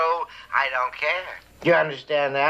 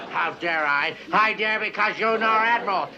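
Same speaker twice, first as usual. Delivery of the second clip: ordinary talk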